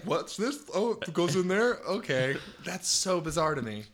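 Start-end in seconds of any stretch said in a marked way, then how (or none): 0.0-2.4 higher, puzzled tourist voice; clueless Oregonian. Retold or imitated. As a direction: play lost at the pump. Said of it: "they come here and they're like".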